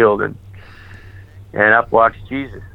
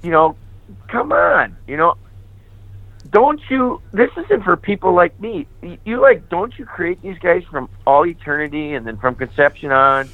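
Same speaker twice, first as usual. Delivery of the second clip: high, pleading disbelief at the calling; exasperated pleading; 3 semitones higher than usual. Retold or imitated. Telling Jesus he picked the wrong man, in high exasperated pleading. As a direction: argue your way out, voice climbing high.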